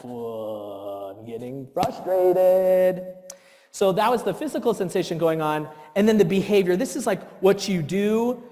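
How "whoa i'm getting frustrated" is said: rising frustration. Retold or imitated. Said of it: "that lump in my throat".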